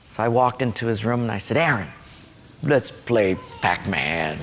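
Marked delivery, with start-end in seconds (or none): 1.6-4.4 low, very slow invitation; tender reconciling. Retold or imitated. Dad walking into son's room to make up, tender reconciling. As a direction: offer peace gently to a child. Father makes peace with his son.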